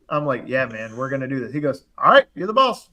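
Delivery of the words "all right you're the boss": high-pitched grudging surrender; salty cousin. Retold or imitated. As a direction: imitate a cousin giving in under protest. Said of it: "he's salty".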